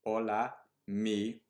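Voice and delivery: loud, halting pupil reading out Hola; reading badly. Shown as a demonstration. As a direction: stumble over the words like a struggling pupil. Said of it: "if I'm gonna read it badly".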